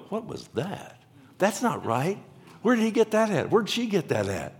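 high-pitched, disbelieving questions; incredulous. Acted. Listener hears teaching contrary to the Word, acted incredulous. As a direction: react as if an alarm just went off.

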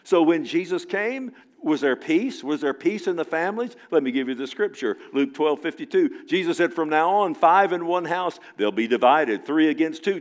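loud, high, rousing sermon voice; fiery preaching. Acted. Preacher rousing the congregation about divided families, loud and fervent. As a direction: preach it out to the whole church.

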